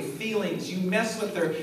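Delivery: ordinary talk, nothing marked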